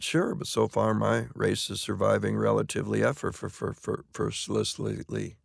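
tongue trips, stuck repeating "for, for, for" before "effortlessly"; stumbling over the line. Shown as a demonstration. Actor on stage trips over a tongue-twister line, stammering. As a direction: fumble the word "effortlessly", stammering on "for". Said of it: "It just wouldn't".

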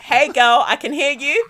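loud, sing-out mock-friendly hello; knowing call-out, each greeting set apart; pointed greeting. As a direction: let them know you overheard everything. Breaking in on open mic.

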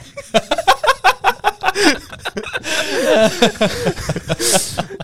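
high-pitched burst of laughter; laughing. Real, not acted. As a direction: cracks up at his own joke. Laughs at own joke.

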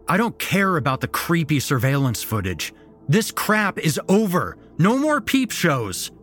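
high, loud furious shout; fast at first, then yell it; angry outburst. A narrator's reading. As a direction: grandson shouting at the grandpa.

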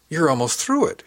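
high, loud burst of surprise; exclaiming. Acted. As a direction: exclaim it in amazement. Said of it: "she exclaimed".

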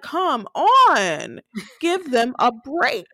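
slow, drawn-out exasperation; mock-pleading, stretched for emphasis; exasperated plea. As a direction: drag the words out in protest. Own exasperated reaction, retold.

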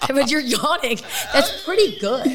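laughing while teasing. Real, not acted. Teasing her cohost about yawning, laughing. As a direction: laugh through the words.

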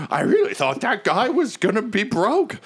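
high put-on character voice; gossiping smoker. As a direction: gossip over a cigarette in a high voice. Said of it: "and he's like".

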